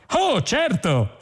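high, slow, sing-song computer cheer; overly eager machine politeness; chirpy computer. A narrator's reading. Ship computer humouring Zaphod, high sing-song cheer.

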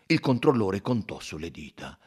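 plain reading voice

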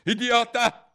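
high, loud outburst from an irritated queen; impatient insult. A narrator's reading. (urlo spazientito)

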